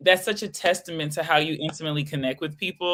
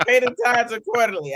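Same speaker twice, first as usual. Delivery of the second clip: mock preaching; 6 semitones higher, faster than usual. Echoed, faster.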